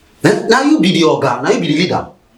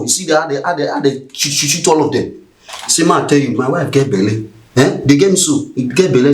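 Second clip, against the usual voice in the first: usual speaking voice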